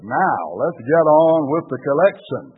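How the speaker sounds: acted exasperation, voice up; impatient demand. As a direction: demand it forcefully from the front row. Front-row man demands the collection.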